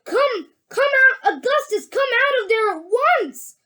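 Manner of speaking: high, insistent calling; yelling. A narrator's reading. Mrs. Gloop calling her trapped son, yelling high. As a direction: order him out in a high yell. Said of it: "yelled Missus Gloop".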